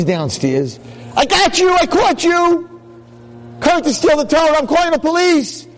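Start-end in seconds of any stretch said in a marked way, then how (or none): 1.2-5.7 nab the robber at full voice; caught-you shout. Acted. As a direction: rabbi shouting at a burglar. Rabbi catches the supposed thief.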